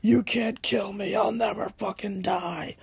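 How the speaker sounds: high, hammed-up defiance; mock crazed refusal to die; defiant dying man. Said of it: "he's like".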